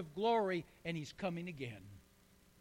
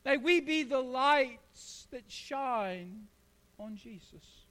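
very slow, lingering on each word; slow preached blessing; 4 semitones higher, 5 dB louder, slower than usual. Acted. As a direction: stretch the words like a benediction.

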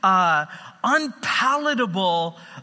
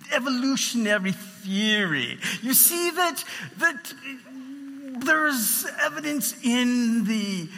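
higher-pitched mock British accent; Richard Dawkins; 5 semitones higher than usual. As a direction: imitate a British pundit pronouncing with lofty authority. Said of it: "he says it with a British accent".

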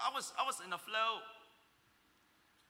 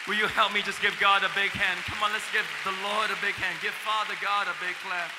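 much louder, fast call to the crowd; rousing shout; 13 dB louder, faster than usual. Real, not acted. Preacher urging the church to applaud, rousing. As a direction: fire up the congregation.

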